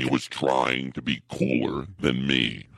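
slow, enunciated repeat; slowed, careful delivery; slow and deliberate. Acted. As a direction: draw it out slowly this time. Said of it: "slowly and deeply".

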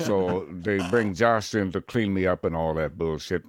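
ageing narrator explaining himself; older actor impression with lip flap; thin, loose-lipped veteran narrator; Morgan Freeman. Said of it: "he sounds a bit more like this".

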